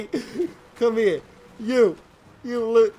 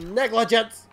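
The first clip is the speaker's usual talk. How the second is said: ordinary talk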